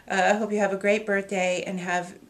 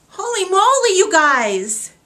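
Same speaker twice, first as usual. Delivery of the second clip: overwhelmed exclaim; 10 semitones higher, 13 dB louder than usual. Real, not acted. Overwhelmed by the quilt gift.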